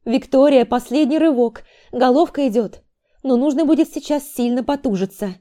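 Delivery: high, loud firm instructions; lightly voiced midwife urging the last push; commanding midwife. A narrator's reading. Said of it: "велела ей акушерка".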